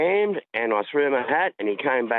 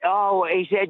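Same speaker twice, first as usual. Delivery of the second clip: ordinary delivery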